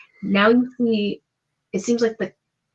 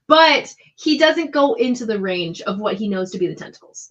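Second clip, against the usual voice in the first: normal talking voice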